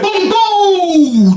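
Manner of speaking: high, loud, drawn-out booms; exaggerated impact sound effects; double punch noise. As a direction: voice two big hits landing. Imitates the punches again.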